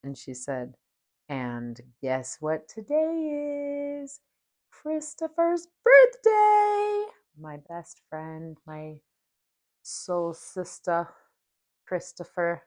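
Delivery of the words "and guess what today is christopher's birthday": slow, much higher, loud joyful shout; giddy reveal. Retold or imitated. Spirit guide revealing the friend's birthday, giddy loud shout. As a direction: build up then burst out the birthday news. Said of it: "she got all giddy".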